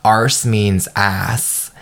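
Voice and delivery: very slow, deliberate explanation; patient slow clarification of arse; slow explaining. Retold or imitated. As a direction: spell it out slowly for her. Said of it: "he literally was like".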